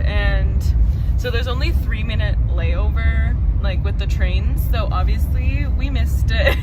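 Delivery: loud voice over train noise; shouting on a subway. Real, not acted.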